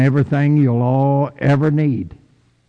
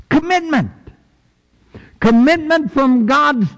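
proclaiming; 10 semitones higher than usual. Real, not acted. Preacher proclaims keyword.

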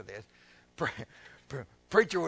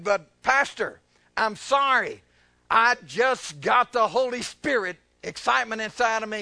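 raised, earnest pleading excuse; imitated apology; 5 semitones higher, 7 dB louder than usual. Lady apologizing to her pastor, imitated pleading. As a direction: act the excited lady explaining herself.

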